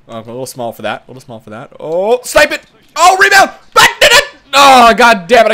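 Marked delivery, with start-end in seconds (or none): 1.8-5.5 high, loud yells at the play; frantic excitement. Real, not acted. Gamer urging himself to shoot the rebound, frantic. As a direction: shout urgently, ending in loud frustration. Urging a shot, then missing it.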